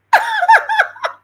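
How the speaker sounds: sudden high burst of laughter. Real, not acted. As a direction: laugh out loud, real and sudden. Laughs at the officer's question.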